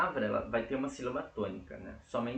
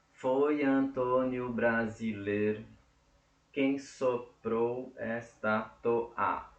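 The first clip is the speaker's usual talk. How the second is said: slow, evenly separated syllables; syllable-by-syllable counting; much slower than usual. Shown as a demonstration. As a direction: sound out each syllable for counting. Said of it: "tem sete sílabas poéticas".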